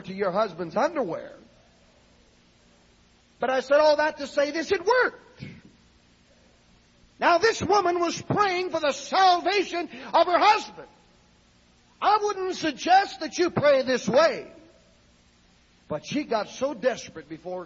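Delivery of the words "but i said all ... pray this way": high, loud pulpit proclamation; full-force preaching. Acted. Preacher telling church it worked, raised preaching voice. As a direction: drive it home to the congregation.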